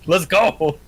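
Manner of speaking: loud, high, laughing hype shout; triumphant cheer. Acted. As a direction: cheer it out like a victory. Cheering after being healed.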